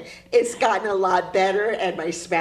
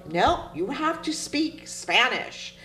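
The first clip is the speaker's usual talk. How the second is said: stern father; 6 semitones higher than usual. Retold or imitated. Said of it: "my father would say".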